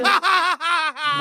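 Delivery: high burst of laughter. Real, not acted. Laughs at own punchline.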